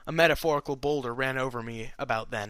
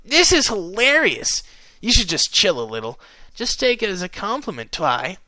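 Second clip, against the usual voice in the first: high, grinning amusement, loud at the start; gleeful outburst easing into casual advice; mirthful; 6 semitones higher, 4 dB louder than usual. A narrator's reading. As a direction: tease a friend, thoroughly entertained. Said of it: "grinning mirthfully".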